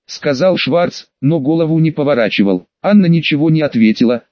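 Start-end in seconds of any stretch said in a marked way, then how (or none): none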